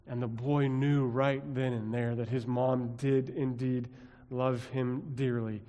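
ordinary delivery

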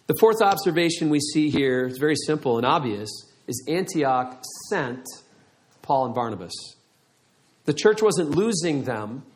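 ordinary talk

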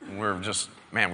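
ordinary talk

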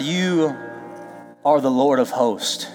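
usual speaking voice

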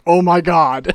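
hammed-up yell of dismay; mock shocked outcry. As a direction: burst out in playful shock. Acts ghost's reaction to thrown brick.

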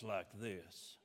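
slow, low drawn-out Southern impression; Nick. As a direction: mimic a friend's low, slow, soft talk. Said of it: "I do a really good Nick impression".

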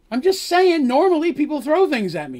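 high, loud defensive whine; indignant protest. Acted. Dandy answering Darsh's shut up, high and loud. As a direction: protest with wounded pride.

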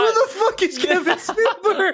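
high-pitched incredulous outburst through laughter; real, laughing squeal of a question; laughing disbelief. Real, not acted. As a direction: demand it while cracking up. Incredulous at the wrong name.

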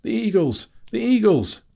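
high, loud excited shout; ringing high yell; crying out. A narrator's reading. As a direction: cry it out as high and loud as you can. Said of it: "Bilbo cried".

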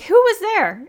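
high and loud, out of breath from running; breathless high call. Retold or imitated. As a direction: burst in high and loud, panting. Out of breath, confronting guys.

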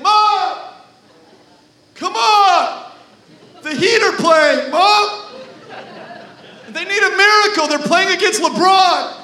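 put-on teenage son begging his mom, loud; whiny teenager. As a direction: whine at mom to get out of chores. Teen Jesus whining to mom.

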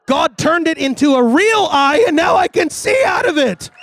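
high, loud acted testimony climax; triumphant shout. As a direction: shout the miracle to the whole church.

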